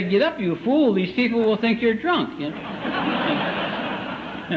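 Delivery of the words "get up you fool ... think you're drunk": higher-pitched urgent telling-off; acted indignant nagging; scolding brain. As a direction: scold him for making a scene. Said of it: "my brain would say".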